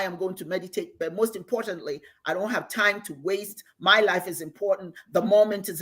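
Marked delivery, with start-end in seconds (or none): none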